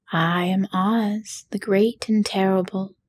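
usual speaking voice

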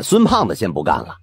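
ordinary delivery, nothing marked

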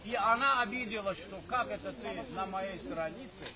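normal talking voice

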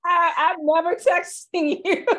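high, loud, through laughter; laughing. Real, not acted. Teasing an Android user about texting, laughing. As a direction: crack up while teasing.

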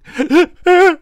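high, loud comic sobs; hammed-up wailing; mock crying. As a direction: bawl like a heartbroken little cell. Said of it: "this little cell crying".

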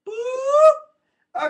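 long squeaky boop. Pretend. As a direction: stretch a shrill cartoon "boop". Loud drawn-out boop.

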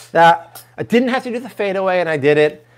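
normal talking voice

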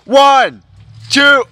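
high, loud count; shouted pin count. Acted. Commentator counts the pin, high shouted numbers. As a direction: bellow each number separately.

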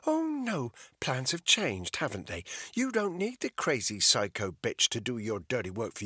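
mock him with a cold, rising sneer; taunting Bethany. Bethany voiced defiant, taunting.

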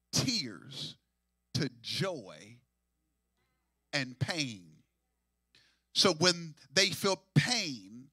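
low, slow and soft, word by word; slow, low emphasis. Acted. Preacher explaining tears slowly to the congregation. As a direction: lay each word down slowly.

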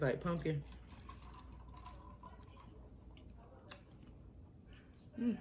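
humming while chewing cake; muffled with food in mouth; mouth full, chewing. Real, not acted. Seen 0: Left speaker chews snack cake, bites again mid-clip, hums between bites.

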